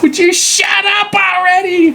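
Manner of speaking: high, drawn-out comic screech; exasperated mother yelling. Acted. Ghost mother to her crying baby, exasperated screech.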